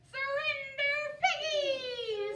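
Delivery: high, loud sing-song "Surrender"; witchy command. Acted. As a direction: order them in a witchy chant.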